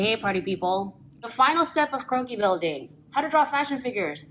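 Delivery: ordinary talk, nothing marked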